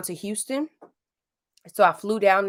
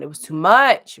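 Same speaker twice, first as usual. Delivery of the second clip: louder, punched "it was too much"; emphatic complaint; 8 dB louder than usual. Real, not acted. Venting to viewers about the heat, emphatic. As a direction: lean hard into "too much".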